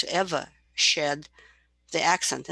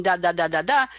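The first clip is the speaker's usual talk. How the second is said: imitating baby babble; 7 dB louder than usual. Shown as a demonstration. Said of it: "instead of saying".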